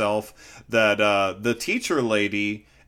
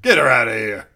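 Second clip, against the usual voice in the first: louder, brushing-off tone; lightly voiced dismissive townsfolk; 2 semitones lower, 6 dB louder, faster than usual. Townsfolk brushing off the old woman, loud and dismissive. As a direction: wave her off casually. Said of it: "Of just like".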